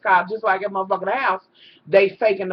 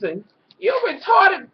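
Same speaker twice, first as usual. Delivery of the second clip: high, loud jab at the end; hammed-up insulting shout; mock yell; 8 semitones higher, 4 dB louder than usual. Vlogger imitating Stevie's insult, a mock high shout.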